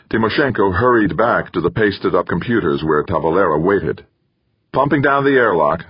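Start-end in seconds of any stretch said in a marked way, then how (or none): 4.7-5.8 raised, higher call across a room. Acted. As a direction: call it out to the airlock.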